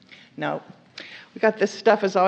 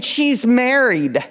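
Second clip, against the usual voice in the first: "married" drawn out slow and louder; stress on married; 2 semitones higher, 6 dB louder, much slower than usual. Acted. Servant warning David, stressing "married". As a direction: lean hard on "married". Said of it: "Emphasis on the married".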